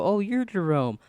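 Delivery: a lighter mock-dramatic chorus of recognition; TV-show recognition. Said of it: "like, a T V show".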